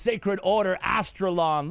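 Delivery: normal talking voice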